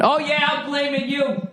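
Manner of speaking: high, soft mocking whine. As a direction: sneer it in a squeaky put-on voice. Mock voice of egging listeners.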